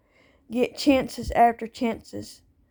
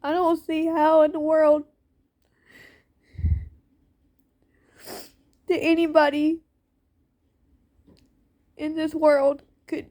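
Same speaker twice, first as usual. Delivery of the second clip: real upset, voice pitched up, holding back tears; higher, tearful voice with long halting pauses; getting emotional; 4 semitones higher than usual. Real, not acted. Parent who lost their kids venting about being done wrong, emotional. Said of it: "I'm sorry that I'm getting emotional".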